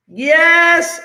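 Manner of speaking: high, loud yes; excited yes. Real, not acted. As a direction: cheer the right answer. Reacting to a student's answer.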